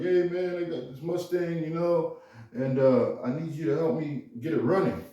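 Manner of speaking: put-on drunk brother. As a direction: play the tipsy brother calling for help. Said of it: "He's like".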